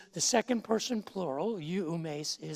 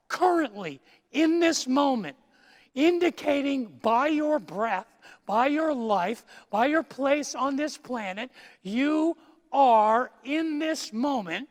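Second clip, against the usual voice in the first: full-force preaching; 6 semitones higher, 8 dB louder than usual. Acted. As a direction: proclaim it to the congregation.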